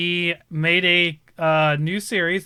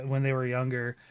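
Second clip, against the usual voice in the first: ordinary delivery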